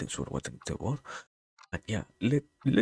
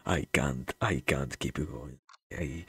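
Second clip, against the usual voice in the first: normal talking voice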